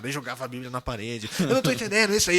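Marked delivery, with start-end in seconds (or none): 1.4-2.4 higher, louder mock exasperation; acting out an annoyed complaint; retold frustration. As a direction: complain like a baffled, cross Bible reader. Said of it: "eu ficava bravo".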